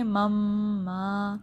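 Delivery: slow nasal hold on "مم"; ghunnah. Shown as a demonstration. Teacher modelling "مم ما", sustained humming m. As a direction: hum the doubled m of "مم" long, then hold "ما". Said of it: "کہ غنہ ہوتا ہے".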